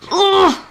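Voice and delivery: loud, high annoyed groan; exasperated grunt at herself; frustrated ugh. Real, not acted. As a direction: groan at your own mistake. Nearly used wrong brush.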